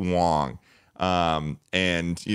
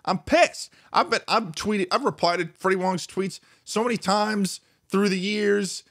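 mock indignation; 11 semitones higher than usual. Mock outrage at being ignored.

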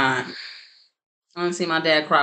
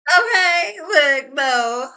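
surprised outcry; 8 semitones higher, much slower than usual. Real, not acted. Reader reacting to the card with a startled high cry. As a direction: shout out in shock at the card.

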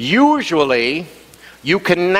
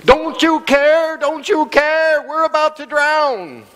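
panicked plea; 10 semitones higher, slower than usual. Acted. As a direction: cry out to a sleeping Jesus as the boat sinks. Peter wakes Jesus in storm.

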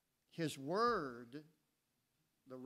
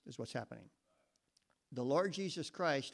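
ordinary delivery

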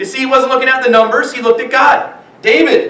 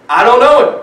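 a touch louder, insistent denial; Peter's third denial, more forceful; 4 dB louder than usual. Acted. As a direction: push back hard the third time. Peter denying Jesus, acted quote.

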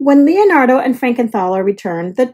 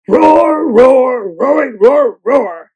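monster roar; 4 dB louder, slower than usual. Acted. The monster roars at Sam.

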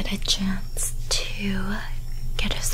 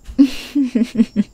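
teasing giggle; 3 semitones higher, 6 dB louder than usual. Acted. Teasing laugh after the whispers.

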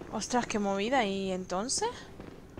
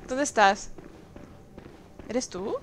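ordinary talk, nothing marked

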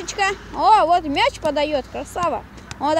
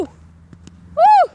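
loud whoop; 14 semitones higher, 8 dB louder than usual. Real, not acted. Goalkeeper's burst during kick.

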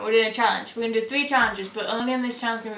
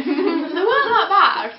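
laughing; 6 semitones higher, 7 dB louder, slower than usual. Real, not acted. Laughs after eating.

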